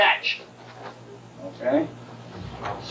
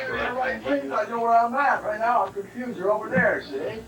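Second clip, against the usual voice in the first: playfully raised pitch, acting lost; mock confused partner; 8 semitones higher than usual. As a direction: pretend to be the confused attacker, voice pitched up. Teacher plays confused partner.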